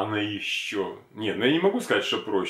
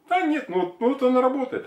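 high mock owner voice; 3 dB louder than usual. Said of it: "он говорит".

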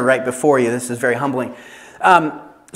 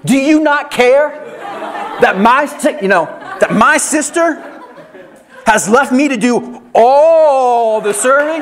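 high, loud outraged complaint with laughter breaking in; indignant shout with a laugh in the voice; Martha in a rage; 7 semitones higher, 3 dB louder than usual. Acted. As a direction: shout it like a fed-up sister. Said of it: "intercept a woman in her rage".

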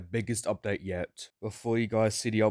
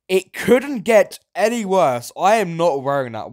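shrill outraged reaction; disgusted; 9 semitones higher, 11 dB louder than usual. Real, not acted. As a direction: recoil from the costume. Reacting to ugly costume.

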